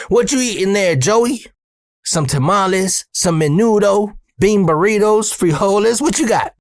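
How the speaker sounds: imitated needling, item by item, pitched well up; Earl ribbing. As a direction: talk down to a worker as if to a baby. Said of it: "as if I'm a baby in a car seat".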